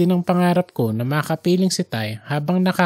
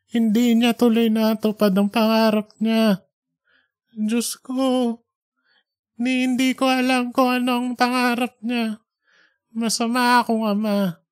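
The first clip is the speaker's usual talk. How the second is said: higher, pleading prayer to God, full of regret; a contrite father's confession, voice raised high; remorseful old father praying; 5 semitones higher than usual. Acted. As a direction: beg God's forgiveness as a guilty old father.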